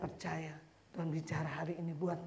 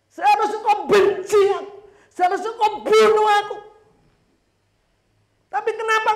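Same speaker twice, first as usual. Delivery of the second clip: much higher and louder, an anguished outcry; pleading murderer; 15 dB louder than usual. Retold or imitated. The murderer pleads loudly to the forgiving mother. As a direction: cry out in disbelief at her mercy.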